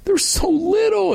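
imitating a high, anguished scream of grief. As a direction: shriek it like a bystander seeing the bodies. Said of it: "someone in the background screaming".

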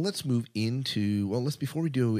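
ordinary talk, nothing marked

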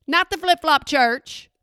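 usual speaking voice

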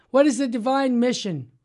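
ordinary delivery

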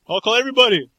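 a scared guy bluffing to buy time; panicky bluster. Acted. Mocking a cornered bluffer, blurting a loud panicky threat.

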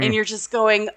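normal talking voice

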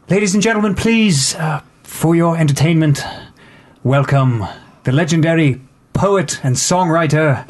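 lady making an announcement. Acted. Lady introduces the poet to the gathered guests, announcing. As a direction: address the room as a hostess introducing an act.

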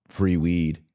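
ordinary talk

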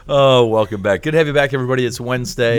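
high, loud upbeat greeting to listeners; host welcome. Acted. Host welcoming listeners back to the show, upbeat and loud. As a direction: greet the audience like a cheerful radio host.

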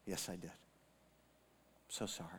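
hushed, slow, remorseful confession; barely voiced, slow apology; an old father's whispered sorry; near whisper. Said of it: "He said".

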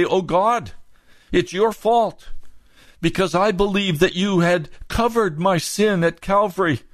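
high, whining plea to God; pleading sinner. Retold or imitated. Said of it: "Shall we turn and say".

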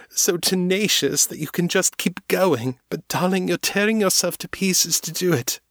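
high, tearful, insistent plea; tearful pleading. A narrator's reading. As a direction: beg her to see the truth through tears. Morgan pleads in tears.

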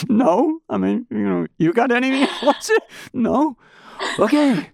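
high, giggly, embarrassed answers; a cornered reporter giggling his replies to the boss; sheepish laughing replies. Retold or imitated. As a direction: laugh through the awkward no.

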